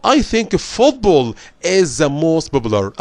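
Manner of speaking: slow, careful model answer; slow English reading voice. Acted. Teacher reading the model answer for students. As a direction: read the answer slowly for the class.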